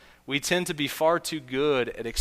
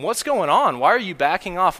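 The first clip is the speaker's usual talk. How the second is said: teammates pressing; 5 semitones higher, 6 dB louder than usual. Retold or imitated. Teammates questioning player.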